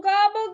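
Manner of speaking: high, loud gobbling call; turkey gobble. A narrator's reading. Narrator imitates the turkey in church, gobbling high.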